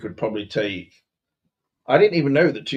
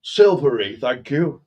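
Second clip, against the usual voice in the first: high, loud posh indignation, settling as it goes; hammed-up affronted posh English gentleman; faux posh outrage; 6 semitones higher, 5 dB louder than usual. Posh master ordering the boy to polish silver, mock indignant. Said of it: "but faux posh".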